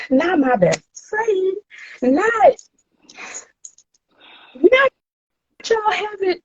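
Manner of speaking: high, building incredulous outrage. Retold or imitated. Outrage at cousins' betrayal.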